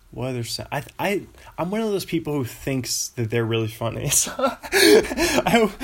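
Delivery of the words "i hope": laughing. Real, not acted. Laughs at own joke.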